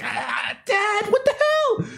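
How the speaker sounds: put-on child voice. Kid yelling at dad.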